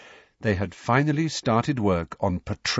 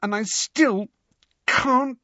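angrily; 13 semitones higher, slower than usual. A narrator's reading. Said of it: "she added angrily".

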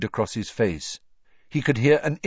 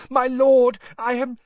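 gasping; 6 dB louder than usual. A narrator's reading. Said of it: "gasped the man on the floor".

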